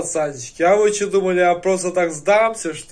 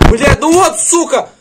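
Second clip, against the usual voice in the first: raised voice; 10 semitones higher, 8 dB louder than usual. Real, not acted. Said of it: "я немного повысил".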